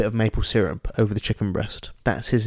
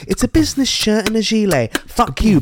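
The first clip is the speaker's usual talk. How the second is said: high, hammed-up slogan; mock advert hype; 8 semitones higher than usual. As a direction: sell it like an overexcited advert.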